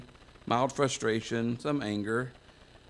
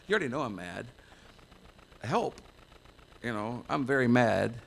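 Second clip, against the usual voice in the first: ordinary talk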